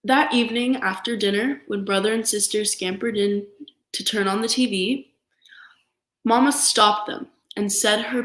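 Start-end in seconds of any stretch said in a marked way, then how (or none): none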